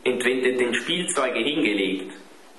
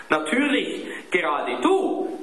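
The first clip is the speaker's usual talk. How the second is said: mild narrated outrage, high then slowing; a hint of a boy's sarcastic indignation; indignant shout; 9 semitones higher than usual. A narrator's reading. Klaus accusing his friend, mild indignant outburst. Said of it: "schrie Klaus empört".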